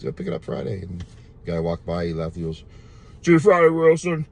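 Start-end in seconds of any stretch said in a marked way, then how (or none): 3.2-4.3 mock big-guy voice, higher and louder, hammed up; big guy impression. Burly customer calling goodbye to the salesman, mock booming voice. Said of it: "he's talking from his belly. He got a big, deep voice".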